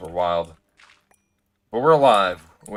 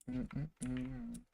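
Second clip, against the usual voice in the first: humming; 13 semitones higher, 14 dB quieter than usual. Real, not acted. Hums while waiting for machine.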